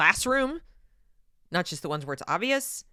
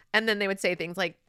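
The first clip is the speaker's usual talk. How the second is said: ordinary talk, nothing marked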